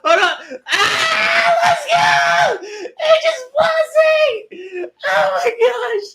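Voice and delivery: high, loud, drawn-out screaming; excited screaming. Real, not acted. Reacting to a surprise on stream, excited screaming.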